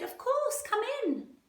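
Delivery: mummy welcomes the tiger in a high, cheerful voice. Acted. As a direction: answer the door like a chirpy mum. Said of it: "Sophie's mummy said".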